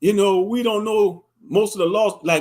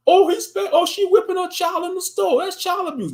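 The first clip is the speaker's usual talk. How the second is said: sneering Karen voice, much higher; mock Karen; 11 semitones higher than usual. Karen reporting a mother in the store, high mock alarm. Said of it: "goddamn Karens gonna try to call the damn police".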